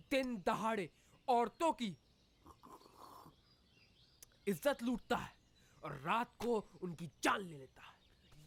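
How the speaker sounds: mock newsreader delivery; news read.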